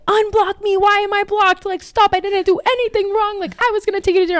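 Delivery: high, loud imitation of frantic protesting; mock yelling. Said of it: "he's just yelling at me again".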